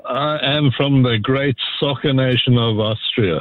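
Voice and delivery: measured Austrian-accented English; Austrian accent, almost South African. Real, not acted. Caller announcing his World Cup nation, Austrian accent. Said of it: "Almost a little South African there".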